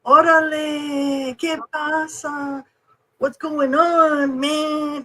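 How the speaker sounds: high, stretched-out lazy greeting; slow, laid-back local. Retold or imitated. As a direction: imitate an easygoing New Mexican, dragging every word. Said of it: "It's so slow. Everybody's like".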